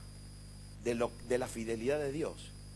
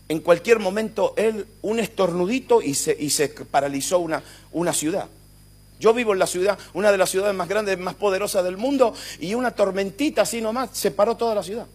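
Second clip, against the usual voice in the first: loud, driving sermon voice; impassioned preaching; preaching at full force; 5 semitones higher, 10 dB louder than usual. Acted. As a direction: press the point hard to the church.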